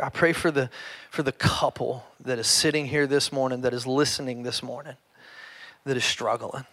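hushed, earnest prayer voice. Real, not acted. Pastor praying quietly over his church, hushed and earnest. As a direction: pray softly over the congregation.

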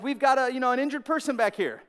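normal talking voice

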